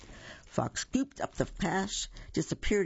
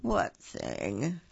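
sleepy bear; 7 semitones lower than usual. A narrator's reading. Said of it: "still half asleep".

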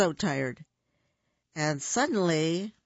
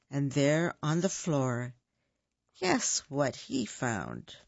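ordinary delivery, nothing marked

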